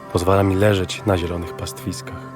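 ordinary talk

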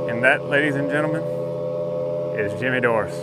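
booming crowd-pleasing showman; announcer hype. Acted. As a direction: present the catch like a ringside announcer. Hyped announcer showing off fish.

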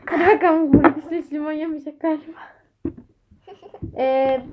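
ordinary talk

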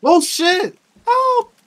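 loud, high-pitched alarm; panicked cry for help. Real, not acted. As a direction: shout out in sudden panic. Caught off guard in game.

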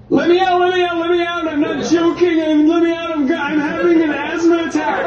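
very high, frantic pleading; panicked screaming. Retold or imitated. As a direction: shriek to be let out. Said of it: "you can't scream like that".

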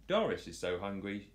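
ordinary delivery, nothing marked

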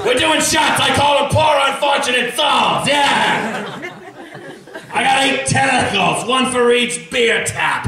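high, laughing sea witch; Ursula voice. Acted. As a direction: whoop it up like a party sea witch. Ursula as party bar woman.

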